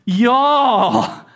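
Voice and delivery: drawled southern y'all, said as jaw; a Texan y'all thrown to the room. Shown as a demonstration. Pastor has the congregation look around and say it, southern. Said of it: "can you look around and say that?".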